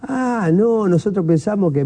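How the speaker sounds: high-pitched mock backtracking; imitated sheepish excuse. Fans excusing themselves to the player, imitated sheepish backtracking. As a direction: imitate someone climbing down from an accusation.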